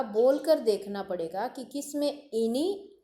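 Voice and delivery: ordinary talk, nothing marked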